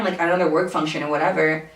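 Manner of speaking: usual speaking voice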